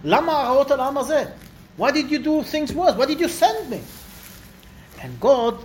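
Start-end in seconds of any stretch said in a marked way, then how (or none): 0.0-3.8 higher, demanding to know why; indignant complaint. Retold or imitated. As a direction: challenge God with raised pitch. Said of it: "looks like a real chutzpah".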